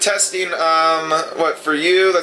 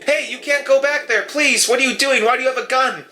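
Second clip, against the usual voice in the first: higher-pitched, urgent nurse objecting; alarmed nurse; 5 semitones higher than usual. Acted. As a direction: plead and protest as the startled nurse. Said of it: "She says".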